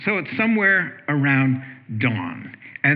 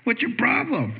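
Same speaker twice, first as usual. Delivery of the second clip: higher, indignant mock accusation; accusing complaint; 8 semitones higher than usual. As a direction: act out the disbelieving scolding. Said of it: "he says".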